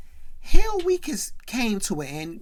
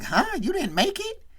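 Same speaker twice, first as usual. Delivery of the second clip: a much higher, disbelieving question; surprised; 9 semitones higher than usual. Retold or imitated. Carlton, told his cousin didn't make it, shocked and high. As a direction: caught off guard, pitch jumping up. Carlton hears Will failed.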